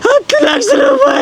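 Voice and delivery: slow, sobbing shout of loss; crying shout. Acted. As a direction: bawl out the lost sum. Man wails over lost lakhs. (ಅಳುತ್ತಾ ಕೂಗುವುದು)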